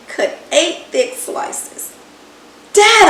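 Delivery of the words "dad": loud call for attention. Acted. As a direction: call out to get his attention. Boy calls to his dad.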